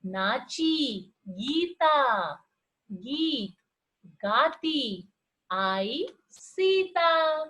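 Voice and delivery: drawn out long ee sounds, very slow; slow reading aloud. Shown as a demonstration. Teacher reading slowly to show the ee sound. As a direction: stretch every word for the class. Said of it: "ई की आवाज आ रही है".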